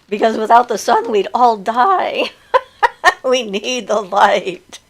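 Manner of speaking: amused, drawn-out words between laughs; laughing. Real, not acted. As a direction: say it through bursts of laughter. Laughing at own blunt remark.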